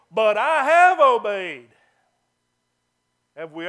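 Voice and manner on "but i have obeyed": loud, slow, indignant protest; stubborn Saul protesting his innocence. Retold or imitated. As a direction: insist on it loudly, offended. Saul's defiant protest.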